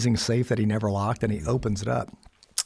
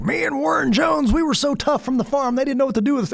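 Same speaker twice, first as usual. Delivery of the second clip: joking grandpa; 13 semitones higher, 4 dB louder than usual. Retold or imitated. Grandpa joking to grandson about his toughness, high loud boasting. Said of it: "he would joke with me".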